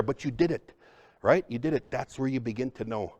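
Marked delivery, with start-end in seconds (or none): none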